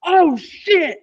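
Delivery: high-pitched, loud cry of alarm; imitated scream. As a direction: scream it as if someone died. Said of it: "Orson screaming".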